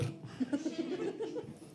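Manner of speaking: soft high giggle; laughing. Real, not acted. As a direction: trail into a high, quiet laugh. Laughs at absurd ending.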